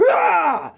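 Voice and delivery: very high battle cry; high rolled villain scream; Electro's attack scream. Acted. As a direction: scream as you fire a blast. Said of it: "And then Electro goes".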